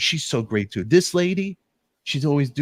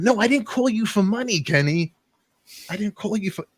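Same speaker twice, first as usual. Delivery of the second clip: indignant protest; 9 semitones higher than usual. Retold or imitated. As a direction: push back like he insulted you. Retold protest to Kenny.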